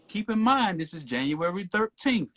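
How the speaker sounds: ordinary delivery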